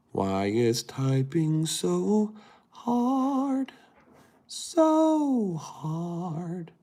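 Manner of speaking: high, slow, drawn-out whine; plaintive, rising comic wail; frustrated wail. Real, not acted. Wailing in frustration while typing.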